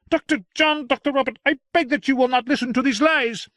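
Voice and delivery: very high, loud aggrieved servant; excited madhouse attendant; whining attendant. Acted. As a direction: complain like a wronged lackey.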